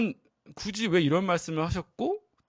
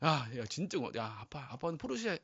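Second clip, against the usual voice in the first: soft, hushed thanks, partly whispered; a moved father thanking his child softly; tender grateful dad; 10 dB quieter than usual. Retold or imitated. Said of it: "막 이렇게 하잖아요". (아이에게 속삭이듯 고마워하는 아빠)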